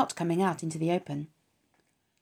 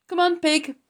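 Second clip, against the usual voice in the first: higher, louder call of a farmhand; a hired man calling the pig to breakfast; 12 semitones higher, 6 dB louder than usual. Acted.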